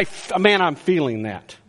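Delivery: usual speaking voice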